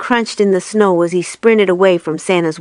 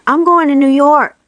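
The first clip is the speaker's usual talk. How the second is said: louder, higher, eager declaration; excited resolve; 6 semitones higher, 4 dB louder than usual. A narrator's reading. Buddy telling Papa Elf he is leaving, excited. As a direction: announce it with fresh determination.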